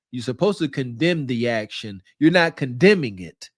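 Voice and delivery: usual speaking voice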